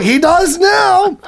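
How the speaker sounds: high, drawn-out mock squeal; re-enacted high-pitched glee; retold gleeful punchline. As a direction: stretch it out high and triumphant.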